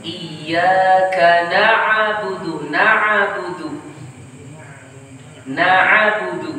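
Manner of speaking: na'budu said as naabudu, giving the ain its own vowel. Shown as a demonstration. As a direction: overdo the ain so it gets a vowel. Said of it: "mengharokatinya karena berlebihan". (ain na'budu sampai berharokat)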